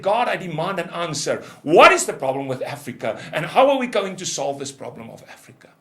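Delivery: loud demand with an edge of despair; demanding shout. Retold or imitated. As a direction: insist on an answer from God. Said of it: "I shouted and cried".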